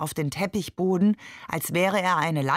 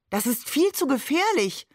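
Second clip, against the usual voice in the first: higher-pitched alarmed exclamation; calling out; 7 semitones higher than usual. A narrator's reading. Wife protesting husband's dangerous trip, calling out. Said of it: "rief Maman auch schon".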